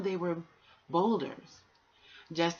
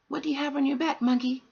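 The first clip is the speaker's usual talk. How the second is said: much higher and louder, a small creature calling out; high tortoise voice; 10 semitones higher, 6 dB louder, faster than usual. Acted. Tortoise asking the monkey in the dark, high and loud. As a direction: pipe it up high like a hidden tortoise. Tortoise asks what he carries.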